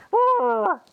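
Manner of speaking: very high, loud mock monkey roar; an over-the-top, hammed-up animal roar. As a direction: squeal it like a cartoon monkey. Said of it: "a monkey going".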